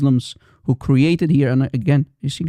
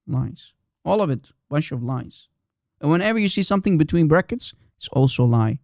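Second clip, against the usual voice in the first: ordinary delivery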